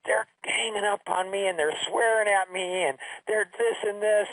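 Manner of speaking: high-pitched mock upset daughter; imitated crying. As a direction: complain like a tearful kid on the phone. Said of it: "she calls me up and she's crying".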